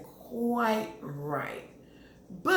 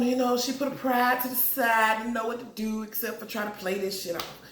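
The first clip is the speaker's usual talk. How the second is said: ordinary delivery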